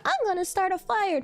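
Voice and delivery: high-pitched mock daredevil kid; reckless kid. Reckless pupil announcing stunts at school, mock daredevil.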